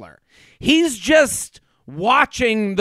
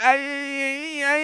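soft, higher, trembling near-tears imitation; about to cry; 6 semitones higher, 6 dB quieter, slower than usual. Imitating a contestant about to cry, soft tearful stammer. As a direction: mimic a voice on the verge of tears. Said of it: "always sounded like she was about to cry".

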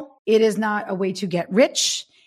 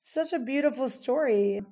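quietly crying, voice catching; choked up; 3 semitones higher, 7 dB quieter than usual. Real, not acted. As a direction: talk through genuine crying. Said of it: "I'm crying".

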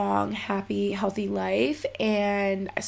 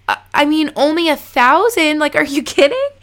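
higher and louder, rushing to answer; eager disbelief; 6 semitones higher, 8 dB louder than usual. Retold or imitated. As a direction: blurt it out like there are too many to count. Asked if they have questions.

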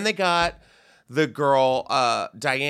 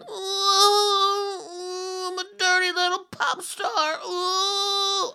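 mock pop star; much slower than usual. Mocking pop star character voice.

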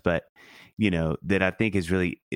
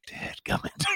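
muttered mock curse; 6 dB quieter, slower than usual. Retold muttered reaction to wife.

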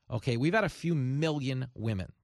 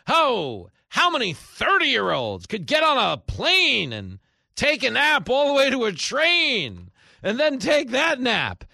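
much higher, louder, slow mock voice; mock her with a slow prissy whine; mocking Jill Biden; 12 semitones higher, 9 dB louder, slower than usual. Host mocking Jill Biden's claim, slow high mock voice.

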